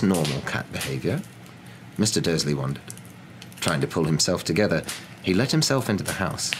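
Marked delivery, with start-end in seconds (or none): none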